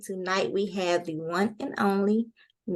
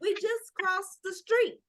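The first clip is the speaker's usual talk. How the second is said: mock disbelief; 11 semitones higher, 4 dB quieter, slower than usual. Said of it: "and I be like".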